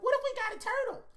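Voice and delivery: put-on high, quick girly voice; wife asking. Wife proposing they get a turtle, high and quick. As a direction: pitch it up like his wife asking sweetly. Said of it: "she was like".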